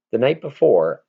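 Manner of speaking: ordinary delivery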